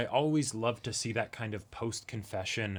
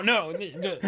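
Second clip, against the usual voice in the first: laughing, higher and louder; 8 semitones higher, 6 dB louder than usual. Real, not acted. Cohost laughing while backing down, laughing agreement. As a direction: laugh through the denial.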